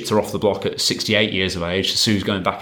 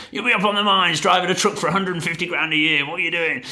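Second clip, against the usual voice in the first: high-pitched, hammed-up Aussie bloke; mock-Australian mate, incredulous and high; strong Aussie accent; 9 semitones higher than usual. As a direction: do your best broad Australian impression, pitched well up. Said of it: "a strong Aussie accent".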